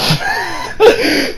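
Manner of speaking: squeaky high-pitched laugh; high squealing laugh. Real, not acted.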